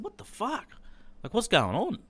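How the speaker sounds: high-pitched, outraged what-the-fuck; angry disbelief. Retold or imitated. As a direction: pitch shooting way up in furious shock. Son phones dad in disbelief.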